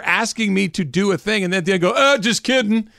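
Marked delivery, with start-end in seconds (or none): 2.0-2.9 high-pitched imitation of a prankster; mocking retold punchline; mock prankster reveal. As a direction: toss it off like a smug prank reveal.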